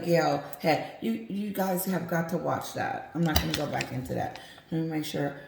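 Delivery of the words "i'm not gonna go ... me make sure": usual speaking voice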